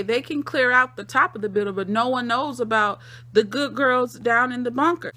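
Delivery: normal talking voice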